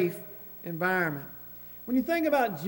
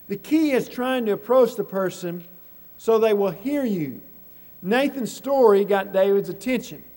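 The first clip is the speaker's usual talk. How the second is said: emphatic preaching; 8 dB louder than usual. Real, not acted. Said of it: "preacher stressing key point".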